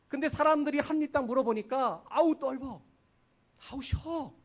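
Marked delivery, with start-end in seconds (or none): 2.1-4.3 mock disgusted taster. People spitting out sour fruit.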